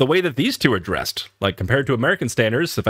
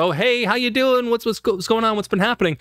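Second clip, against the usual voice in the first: much higher, eager friendly greeting; chummy American greeting; 10 semitones higher than usual. Retold or imitated. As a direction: bounce it like meeting an old pal. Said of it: "she was talking about".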